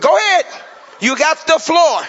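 loud, high laughing challenge; laughing dare. Retold or imitated. Pastor laughing as he dares the lady to go off. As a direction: egg her on through laughter.

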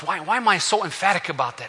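normal talking voice

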